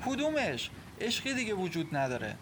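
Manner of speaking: high-pitched, scornful challenge; bitter disbelief. Acted. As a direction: throw the word love back at him. Acted quarrel, wife denies their love. (با ناباوری و تلخی)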